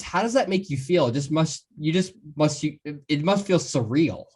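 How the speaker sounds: ordinary talk, nothing marked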